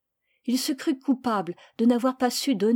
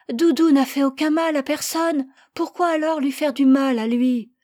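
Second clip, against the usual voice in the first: put-on high, loud pleading little boy; child's voice; 5 semitones higher, 7 dB louder than usual. Boy begging his parents to spare the lamb, high and loud. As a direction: plead like a small boy defending his pet.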